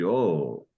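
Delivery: "oh" drawn out, pitch going up and down; sing-song rise and fall through "oh"; circumflex pitch accent. Shown as a demonstration. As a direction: glide up then down on "oh". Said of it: "you'd go up and down".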